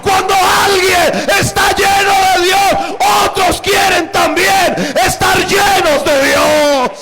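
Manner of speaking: high, loud, impassioned shouting; preacher's climax, shouted at the top of the voice; full-force preaching. Acted.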